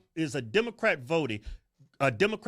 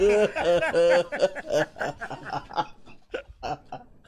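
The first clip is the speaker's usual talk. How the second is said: laughing; 2 semitones higher, 5 dB louder than usual. Retold or imitated. Joking quote to Chris, laughing.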